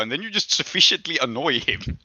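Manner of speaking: ordinary talk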